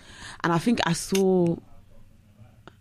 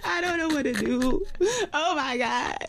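high, slow wailing despair, half laughing; mock despair; 12 semitones higher, slower than usual. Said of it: "messaging me like".

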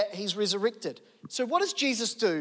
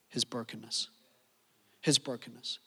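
low and soft, let it sink in; solemn repeat; 9 semitones lower, 6 dB quieter than usual. Real, not acted. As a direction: say it quietly, weighty. Solemn repeated sermon point.

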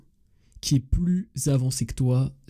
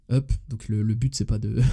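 ordinary talk, nothing marked

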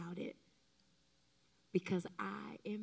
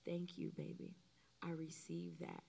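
tender reply; 3 semitones lower, 8 dB quieter than usual. Shown as a demonstration. Modeling a tender reply to a spouse.

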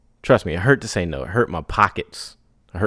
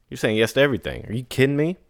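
normal talking voice

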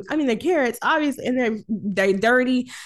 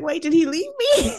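mock alarm; 6 semitones higher than usual. Retelling her panic at being left.

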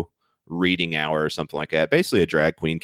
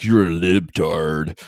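mock critic; 3 dB louder, much slower than usual. Mocking critics' retort.

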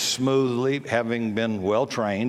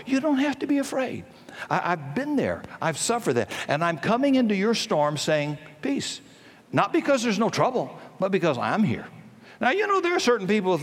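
fervent preaching; 8 semitones higher than usual. Acted. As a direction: voice lifted much higher, pleading and urgent. Preaching with rising intensity.

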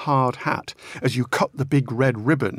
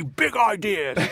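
high-pitched blustering politician impression; Boris; 8 semitones higher, slower than usual. Guest showing how the prime minister says it, blustering impression. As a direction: burst out with it in a high, put-on voice. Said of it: "ruffle your hair and say".

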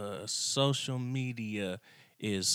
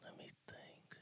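breathy whisper, thinking aloud; soft whispered musing; whisper to self; 26 dB quieter than usual. Real, not acted. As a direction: murmur it under your breath while deciding. Mulling over his top five.

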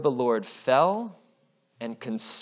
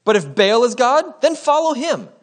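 higher and louder, rising to a ringing call; challenging cry; 7 semitones higher, 6 dB louder than usual. Acted. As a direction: throw the challenge at the crowd. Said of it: "he challenged them".

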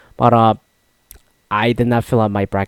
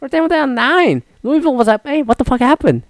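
much higher, incredulous squeak; stunned disbelief; 14 semitones higher than usual. Retold or imitated. Fan learning his team came back to win, in shocked disbelief. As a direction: act out the shock at the comeback win. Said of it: "I was like".

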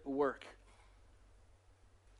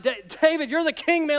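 higher, hurried pleading with a king; flustered deference; 7 semitones higher, 3 dB louder than usual. Acted. Araunah answering the king who wants his land, flustered. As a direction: play a nervous subject, lightly hammed-up.

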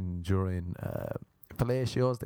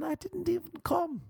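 mock scream. Priests report him screaming, thin high mock scream. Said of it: "He's screaming".

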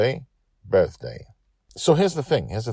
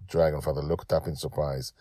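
usual speaking voice

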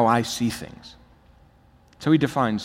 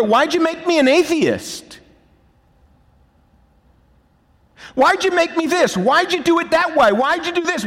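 high, loud, pleading accusation; accusing God; 12 semitones higher, 5 dB louder than usual. Retold or imitated. Preacher voicing sinners blaming God, loud and high. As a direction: hurl the complaints upward.

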